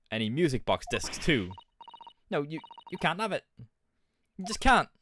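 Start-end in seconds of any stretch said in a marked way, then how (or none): 2.3-4.9 put-on higher, cheeky cockney girl; a scrappy urchin talking back; cockney street urchin. Street girl snapping back at the broker in cockney. Urchin refuses to give disc.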